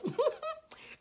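short, high-pitched giggle; high laugh. Real, not acted. Preacher admitting we all get mad, high laugh. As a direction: laugh high at a shared failing.

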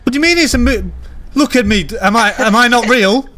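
indignant protest. Pretend. Hero insists to doubter he's real, indignant. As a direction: challenge them, building on the repeat.

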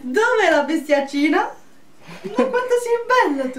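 high cooing pet voice. Real, not acted. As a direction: coo at the dog lovingly. Talking to pet mid video.

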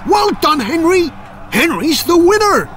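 high, loud excited shouts of a battle seen afar; calling out. A narrator's reading. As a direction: cry out the names as if watching the fight. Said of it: "suddenly called out".

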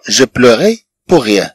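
each word said slowly and clearly, like dictation; slow repeat. Shown as a demonstration. Teacher to students, slowly modelling the sentence. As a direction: lay the phrase out slowly for learners. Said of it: "teacher repeating the phrase".

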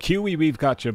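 ordinary talk

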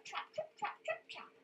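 high, light "trip, trap" for the tiny goat; little goat steps. Shown as a demonstration. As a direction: make the tiniest hooves patter. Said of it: "the little billy goat's gonna go".

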